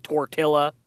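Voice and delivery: "tortilla" with the double L sounded as a plain L; mispronounced tortilla. Retold or imitated. Imitating locals who botch Spanish words, anglicised "tortilla". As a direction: say "tortilla" as tor-TILL-a. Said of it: "still fuck up with words".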